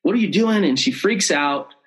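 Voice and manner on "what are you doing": alarmed. Retold or imitated. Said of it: "she's telling him".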